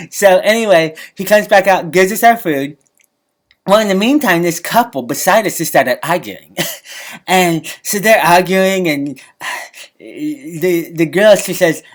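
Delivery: usual speaking voice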